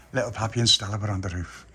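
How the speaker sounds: plain reading voice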